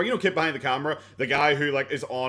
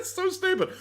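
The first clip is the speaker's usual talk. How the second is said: real laughter, much higher; high laughing fit. Real, not acted. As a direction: crack up then squeak it out. Laughing at Boogie.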